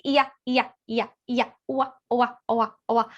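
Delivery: sing-song syllables flowing out; channeled vocalizing. Retold or imitated. Showing viewers how the being spoke through her. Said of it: "It was like".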